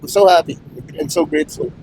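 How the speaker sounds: grateful, holding back tears; happy tears. Real, not acted. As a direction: voice cracking up high. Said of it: "Emotional lang tayo".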